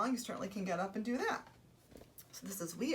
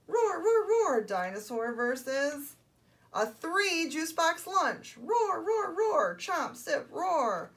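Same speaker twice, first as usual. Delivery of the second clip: high roars with a drawn-out announcement; roaring toddler dinosaur; 8 semitones higher, 7 dB louder, much slower than usual. Acted. Storyteller stages dinosaur versus lunch, roaring and excited. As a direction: build up the opponent slowly, then roar.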